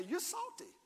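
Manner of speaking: high, playful jab at a neighbor; playful teasing. Shown as a demonstration. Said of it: "Look at your neighbor and say".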